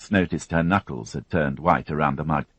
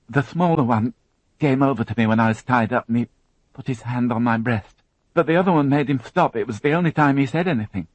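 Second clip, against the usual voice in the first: put-on higher, tense young woman recounting an ordeal; higher, strained witness voice; Alison, shaken young witness; 9 semitones higher, 4 dB louder than usual. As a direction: voice her as a frightened girl holding it together. Alison on assault.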